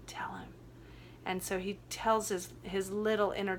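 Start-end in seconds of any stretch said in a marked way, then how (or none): 0.1-0.5 soft whisper, barely voiced; whispered urging. Coach urging a crying client to speak to his inner child, whispered. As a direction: breathe it as a gentle nudge.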